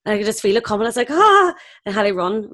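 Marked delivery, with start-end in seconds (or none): 1.1-1.5 a sharp, loud, high gasp as the nausea hits; sudden gasp. Retold or imitated. On set, feeling sick rising suddenly, startled loud gasp. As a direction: gasp it out in alarm.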